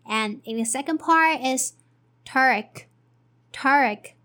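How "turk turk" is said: "Turk" with a weak er vowel, like in mother; unstressed er syllable. Shown as a demonstration. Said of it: "It is er here".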